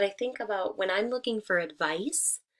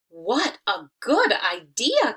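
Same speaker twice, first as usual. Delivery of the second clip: a slow, louder burst of delight; delighted cry; 2 semitones higher, 8 dB louder, slower than usual. A narrator's reading. Baboon thanking the gibbon for his advice, delighted cry. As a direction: cry out as if struck by a brilliant idea. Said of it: "cried the baboon".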